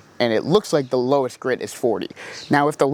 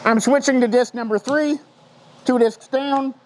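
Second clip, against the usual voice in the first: muffled behind a mask; talking through a dust mask; 5 semitones higher than usual. Real, not acted. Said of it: "I know you can't hear me well in this mask".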